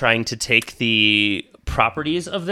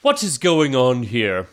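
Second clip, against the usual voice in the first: baron's voice. Acted. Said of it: "and he's like".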